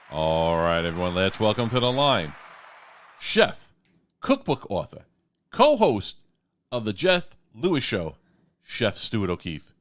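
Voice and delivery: loud, punchy title-by-title welcome; announcer welcome. Acted. Host welcoming the chef on air, loud announcer style. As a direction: roll out each title like a ring announcer.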